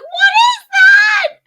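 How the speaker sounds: very high, loud, drawn-out shriek; re-enacted delivery-room shriek; retold scream. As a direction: scream it in alarmed disbelief. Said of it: "I screamed that, like, twice".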